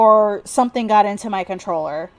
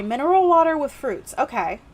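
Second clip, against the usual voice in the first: ordinary talk, nothing marked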